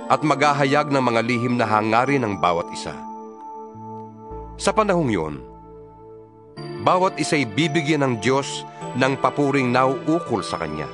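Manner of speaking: ordinary delivery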